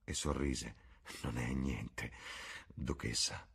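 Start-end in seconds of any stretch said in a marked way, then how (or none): 1.2-3.5 low, soft murmur. A narrator's reading. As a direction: murmur it faintly, pitched well down. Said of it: "mormorò". (mormorio basso e sommesso)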